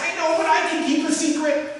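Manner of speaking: put-on mildly high, earnest little boy insisting; lightly voiced child promising he can keep a secret; pleading kid. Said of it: "I said".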